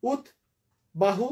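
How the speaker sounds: উদ্বাহু as ud, long pause, bahu; two-part split. Shown as a demonstration. Teacher offering one way to divide it, ud then bahu. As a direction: pause after the first syllable of উদ্বাহু. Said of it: "অথবা".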